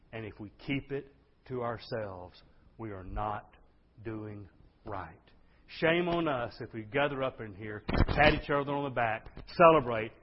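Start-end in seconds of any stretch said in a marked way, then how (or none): 0.1-5.1 soft, lower, measured words; solemn warning. Real, not acted. Preacher warning the church about silence, solemn and soft. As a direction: bring it down and let each phrase land. Solemn take, later repeated forcefully.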